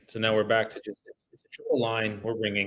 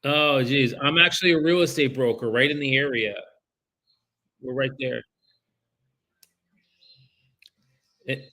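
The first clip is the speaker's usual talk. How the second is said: higher, louder, polite cold-call voice; phone sales voice; 5 semitones higher, 5 dB louder than usual. Real, not acted. Broker cold-calling a homeowner about his listing, upbeat phone sales voice. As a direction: brighten up and pitch yourself to the homeowner.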